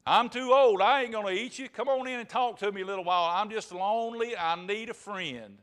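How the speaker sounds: loud, high plea from a lonely wolf; storyteller's poor-old-me wolf; pleading old wolf. Acted. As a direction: wheedle for company, starting loud.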